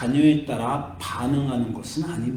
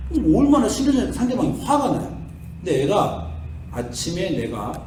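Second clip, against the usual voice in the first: ordinary delivery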